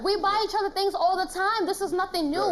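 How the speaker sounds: defending the gift against her accuser; indignant protest. Acted. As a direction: insist it was nothing new. Courtroom quarrel, defending the gift.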